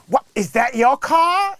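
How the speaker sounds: high, drawn-out mock astonishment; gasp-worthy car reveal, imitated loudly; astonished gold digger. Retold gold digger sees Lambo.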